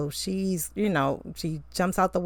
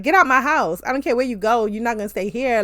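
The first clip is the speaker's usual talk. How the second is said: loud, higher mock telling-off; pretend blowup; 5 semitones higher, 5 dB louder, faster than usual. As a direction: throw her out of the house, pretend fury. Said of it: "I'd have had it out with her".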